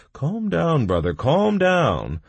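high, urgent placating voice; interrupting to calm. A narrator's reading. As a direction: cut in and settle a ranting friend. Baum interrupts the narrator's rant.

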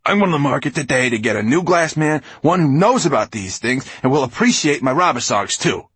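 high, loud tirade; angry threat. A narrator's reading. Writer threatens glass man, loud and angry. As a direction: rant that you will replace him.

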